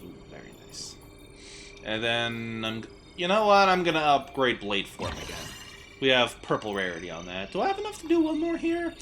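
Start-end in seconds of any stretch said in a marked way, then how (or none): 0.0-1.0 breathy whispered delight; whispered ooh. Real, not acted. 7.5-8.9 high silly voice. Pretend. Sudden high voice.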